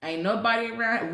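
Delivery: ordinary talk, nothing marked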